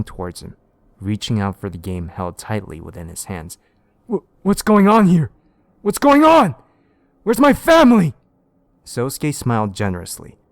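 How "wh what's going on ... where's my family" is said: high, loud frightened questions; panicked. Acted. Detective panicking among the children, high and loud. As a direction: shout in terror and confusion.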